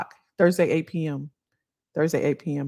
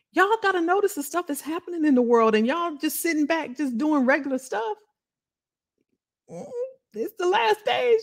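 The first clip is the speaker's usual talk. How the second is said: higher, rising fired-up plea to the viewers; rally the viewers, pitch up and urgent; worked-up preaching to the chat; hyped-up rant; 12 semitones higher than usual. Real, not acted. Rallying viewers about world events.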